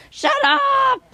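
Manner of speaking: very high-pitched drawn-out screech; imitated parrot scolding. Parrot tells off the noisy dog, high mock screech. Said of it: "the dog too noisy, he say".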